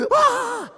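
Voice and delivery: high, loud shriek; panicked rabbit scream. Acted. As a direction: let out a cartoon scream. Rabbit sees turtle ahead.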